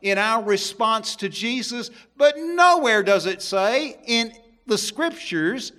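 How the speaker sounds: loud, high preaching from the pulpit; forceful preaching. Acted. Preacher proclaiming to his church, loud and forceful. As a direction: drive the point home to the congregation.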